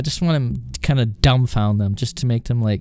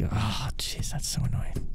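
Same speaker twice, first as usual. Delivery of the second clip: hushed, whispery exasperation; muttered annoyance; 3 semitones lower, 11 dB quieter than usual. Retold or imitated. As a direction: grumble it under your breath. Agent muttering private annoyance.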